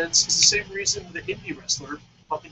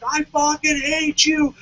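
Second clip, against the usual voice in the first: slow, louder, furious fan yelling; mock yelling fan; 6 semitones higher, 6 dB louder, slower than usual. Heartbroken fan yelling at Dave Bautista, slow loud mock rage. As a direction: bellow it at the wrestler like a crushed fan. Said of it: "he's screaming and yelling".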